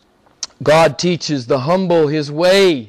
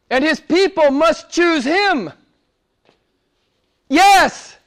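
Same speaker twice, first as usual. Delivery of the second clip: raised, insistent preacher's voice; fervent preaching; 7 semitones higher than usual. Acted. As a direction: press the point hard on the congregation. Preaching at full force.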